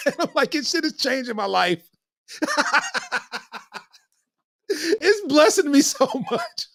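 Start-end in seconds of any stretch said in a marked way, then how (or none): 0.5-2.5 laughing. Retold or imitated. Laughing retelling of first tequila. 4.7-6.7 slow words broken by laughter; laughing. Real, not acted. Laughing through the story.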